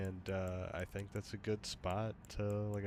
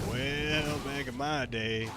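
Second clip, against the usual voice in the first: mock Southern drawl, louder and higher; southern drawl demo; 5 semitones higher, 6 dB louder, much slower than usual. Shown as a demonstration. Gamer asks the AI for a southern drawl. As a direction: drawl it like an old Southern porch sitter. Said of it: "more of a convincing southern accent".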